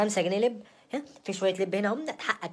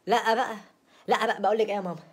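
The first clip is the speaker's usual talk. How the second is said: fed-up protest; 3 semitones higher, 5 dB louder than usual. Acted. Fed up, appeals to mother.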